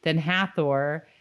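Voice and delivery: usual speaking voice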